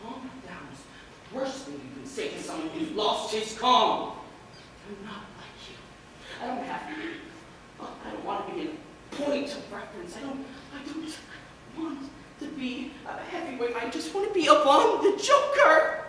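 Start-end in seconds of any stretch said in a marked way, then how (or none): 0.0-2.7 very soft, low quote; subdued. Acted. Spent groom quotes the soothing advice. 4.9-13.8 very soft, halting admission; subdued confession. Acted. Spent groom confesses his modest wishes. 13.8-16.0 rising, higher-pitched plea; pleading declaration. Acted. Confession rises toward outburst.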